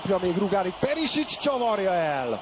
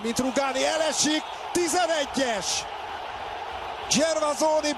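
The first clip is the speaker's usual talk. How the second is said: excited; 11 semitones higher than usual. Real, not acted. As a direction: shout out the penalty. Penalty given in play-by-play.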